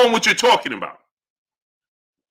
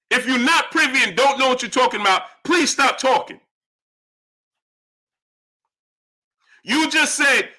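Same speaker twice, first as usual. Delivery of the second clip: real exasperation, pitched up; heated scolding; 5 semitones higher than usual. Real, not acted. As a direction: tell off an ignorant viewer. Scolding a viewer.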